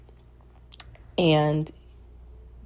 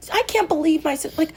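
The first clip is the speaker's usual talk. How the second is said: high-pitched indignant outburst; exasperated, voice jumping way up; irritated; 11 semitones higher than usual. Retold or imitated. Woman blaming her sister for telling dad, irritated. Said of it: "I was just so irritated".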